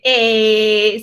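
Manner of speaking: "eh" held very long as an open choral E; long sagging E. Shown as a demonstration. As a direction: draw out "eh" like a choir's wide, sinking vowel. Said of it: "se baja la afinación". ("eh" larga y abierta, como en coro)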